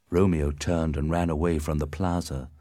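ordinary delivery

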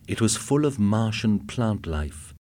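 plain reading voice

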